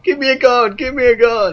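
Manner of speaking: high-pitched pleading imitation; pleading Avi. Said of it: "poor Avi keep going like".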